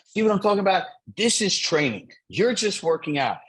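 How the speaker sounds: mock coach yell. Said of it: "he's literally screaming at me".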